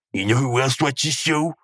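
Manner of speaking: lightly put-on catchphrase voice; catchphrase impression. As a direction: toss out the running gag in a mildly put-on voice.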